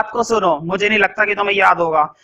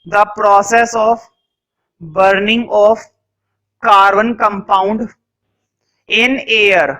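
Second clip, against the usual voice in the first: very slow, word by word with long pauses; slow dictation; much slower than usual. Shown as a demonstration. Teacher dictating a definition for notes, very slow. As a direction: dictate each word so students can write. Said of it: "dictation continues".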